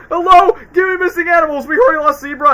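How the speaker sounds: high, loud mock shout into the phone; imitating Alex on the phone. Friend retells Alex phoning the humans, mock shout. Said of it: "he's like".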